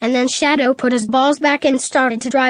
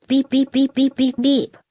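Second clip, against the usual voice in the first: robot beeping. A narrator's reading. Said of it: "screamed the car in a robot voice".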